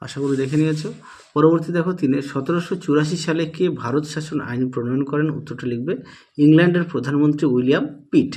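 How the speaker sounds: ordinary talk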